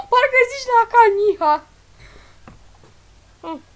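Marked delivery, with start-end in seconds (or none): 0.1-1.6 laughing while talking. Real, not acted. Laughing at the Chinese word.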